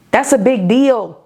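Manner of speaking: said out strong and firm; full voice. Acted. Preacher declares it firmly to her listeners. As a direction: land it firmly after the whisper. Repeats line, now aloud.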